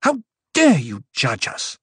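offended and trembling with it; shaken indignation. A narrator's reading. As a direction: demand it, indignant after shuddering breaths. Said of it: "shuddering breaths".

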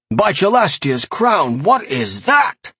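high, drawn-out cry of alarm; crying out in distress. A narrator's reading. Farmer pointing at the imp, crying out. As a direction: recoil in horror at the sight. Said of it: "the man cried out".

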